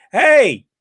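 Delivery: high, loud call imitating a yell; retold yell. Retelling Jarrett calling after a departing man, high yell. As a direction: holler it like calling someone back. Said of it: "And he yells at the guy".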